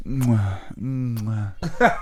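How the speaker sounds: loud smacking kisses into the mic; kissing sounds. Shown as a demonstration. Showing how he kissed the air in the coffee shop, loud smacks. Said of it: "You'll be like".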